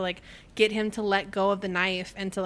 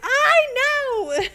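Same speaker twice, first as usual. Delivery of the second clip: very high, loud, drawn-out retort; mock exasperated yell; 8 dB louder than usual. Cinder bickering with Thorn, mock exasperated yell. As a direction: imitate bickering at full exasperation. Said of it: "the bickering that's happening".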